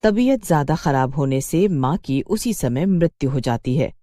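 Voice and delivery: ordinary delivery, nothing marked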